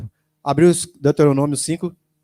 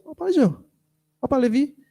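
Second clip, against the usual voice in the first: voiced wife; 10 semitones higher, 3 dB quieter than usual. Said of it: "A Isabela que me mostrou".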